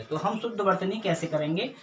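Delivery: ordinary talk, nothing marked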